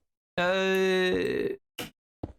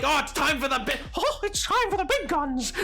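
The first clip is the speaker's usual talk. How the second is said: Mickey voice; 8 semitones higher than usual. Retold or imitated. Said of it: "And then Mickey's like".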